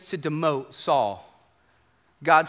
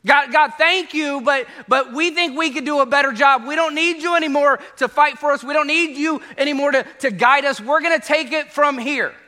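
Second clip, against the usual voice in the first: loud, raised defiant voice brushing God off; defiant Israelites; 7 semitones higher, 4 dB louder than usual. Retold or imitated. Israelites telling God they'll handle it, loud defiance. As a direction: tell God you're done with him, loudly. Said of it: "They're saying".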